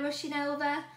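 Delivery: plain reading voice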